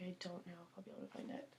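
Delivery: fast, hushed, panicky whisper; a quick anxious whisper under the breath; whispered worry. Retold or imitated. Panicked thought voiced as quote.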